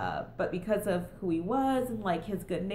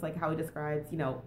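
ordinary delivery

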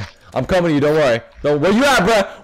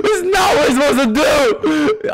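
high-pitched shriek of disbelief; real high, frantic outburst; panicked yelling; 7 semitones higher than usual. Real, not acted. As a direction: scream as the plan falls apart. Panicking as game plan fails.

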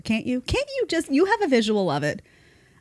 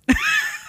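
laugh. Real, not acted. Laughing at own story.

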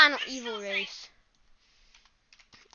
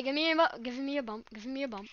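normal talking voice

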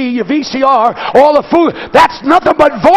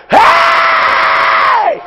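much higher, sudden shout for attention; Bartimaeus calling out; 11 semitones higher than usual. Retold or imitated. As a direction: cry out over the crowd. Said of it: "a man with a need".

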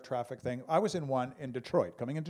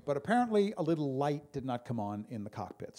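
ordinary talk, nothing marked

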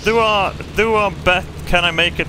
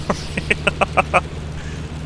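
breathy giggle; 3 semitones higher than usual. Real, not acted. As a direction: giggle it away, embarrassed. Laughs after hitting wrong key.

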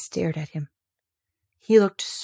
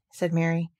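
usual speaking voice

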